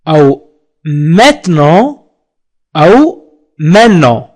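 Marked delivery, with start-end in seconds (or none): 0.8-2.0 "maintenant" shortened to "met-non"; colloquial pronunciation. Shown as a demonstration. Teacher shows learners a spoken variant, colloquial pronunciation. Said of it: "في الشارع يقولون". 3.6-4.2 "maintenant" run together as "men-non"; street pronunciation. Shown as a demonstration. Teacher shows learners another spoken variant, street pronunciation. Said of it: "في الشارع يقولون".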